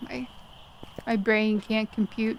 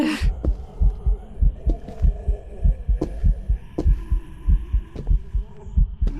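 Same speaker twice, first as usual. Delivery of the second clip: breathy laugh; 4 semitones higher than usual. Real, not acted. Laughing after near miss.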